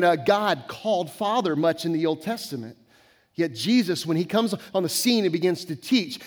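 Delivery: ordinary talk, nothing marked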